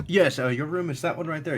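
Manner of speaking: high-pitched, helpful host; Thatch. Acted. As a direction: show a guest the room in a lifted voice. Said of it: "He goes".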